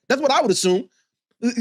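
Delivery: usual speaking voice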